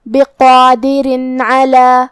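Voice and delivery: بقادر علی with the tanween as a plain nun, no nasal hum; clear nun, no ghunna. Shown as a demonstration. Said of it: "بغیر غنہ سے یہ حکم کو ادا کریں گے". (بغیر غنہ کے ادا)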